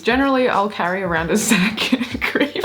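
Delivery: reading that collapses into laughter; giggling. Real, not acted. Reading out a goblin confession, giggling. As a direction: crack up partway through the line. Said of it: "if we keep giggling".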